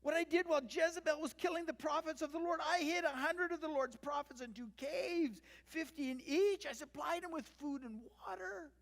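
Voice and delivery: high, loud, acted plea; tearful pleading. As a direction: plead your case as if close to tears. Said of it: "hear the tears in his voice".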